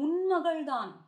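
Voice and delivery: loud, high, exasperated retort with loving anger; upset scolding. Retold or imitated. Andal scolding the mother of the sleeping girl, loud loving anger. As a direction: scold her like a fond but fed-up friend. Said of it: "Andal certainly gets upset".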